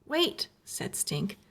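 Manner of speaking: plain reading voice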